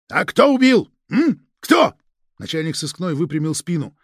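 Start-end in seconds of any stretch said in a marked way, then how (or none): 0.1-1.9 high, loud, demanding interrogation shout; frantic shouting hostage taker. Acted. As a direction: hammer the question at him. (допрос с криком у подозреваемого)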